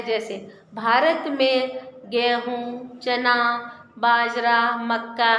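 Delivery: slow list with a pause after each crop; teacher pausing at each comma in a crop list; pauses between items. Shown as a demonstration. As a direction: pause at every comma: गेहूँ, चना, बाजरा, मक्का. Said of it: "जहाँ जहाँ मैं रुकी".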